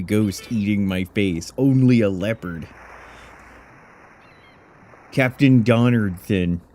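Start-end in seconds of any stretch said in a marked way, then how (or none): none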